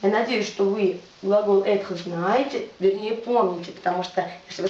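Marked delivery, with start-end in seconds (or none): none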